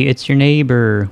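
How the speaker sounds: ordinary talk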